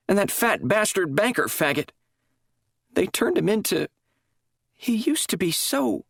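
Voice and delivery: high, halting, breaking voice; tearful confession. A narrator's reading. Drugged nurse confessing to chaplain, tearful halting voice. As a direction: confess between sobs, losing the thread.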